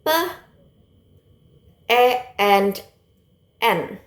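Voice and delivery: "P", "E", "N" as separate sounds with pauses; sounding out letters. Shown as a demonstration. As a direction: break pen into "P", "E", "N", one sound at a time. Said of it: "is consists of sound".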